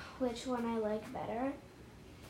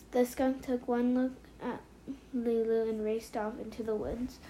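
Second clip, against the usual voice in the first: usual speaking voice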